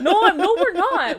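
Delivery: high, loud laughing denial; laughing protest. Real, not acted. Denying the rant length to her co-host, laughing loudly. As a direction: laugh while insisting. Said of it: "towel rant".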